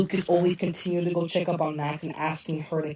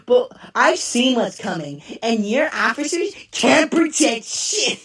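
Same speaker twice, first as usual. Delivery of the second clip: higher, slow, menacing quote; quoting a character; 5 semitones higher, 4 dB louder, slower than usual. Retold or imitated. Kid from the show warning about police, higher slow menacing delivery. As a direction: lean into it like a smug kid threatening cops. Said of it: "some of the lines he said".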